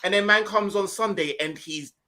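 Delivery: usual speaking voice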